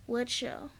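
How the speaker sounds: soft, low-key retold question; imitating a calm, quiet reply. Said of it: "In a very calm voice".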